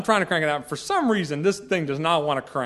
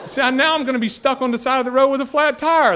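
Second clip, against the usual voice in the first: high and louder through laughter; laughing wife; 8 semitones higher, 5 dB louder than usual. Retold or imitated. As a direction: laugh out the line as the amused wife. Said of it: "she was laughing about it".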